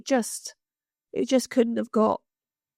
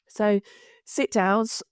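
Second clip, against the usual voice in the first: usual speaking voice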